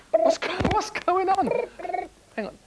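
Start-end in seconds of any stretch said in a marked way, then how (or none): 0.1-1.5 high, slow puzzled question; confused. Pretend. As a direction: sound baffled by the silence. Confused when phone goes dead.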